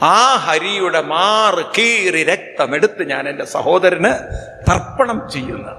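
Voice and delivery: ordinary talk, nothing marked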